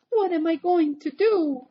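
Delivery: high, loud panicked question; crying out in dismay. Acted. Big Anthony with the ring stuck, high loud panic. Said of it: "he cried".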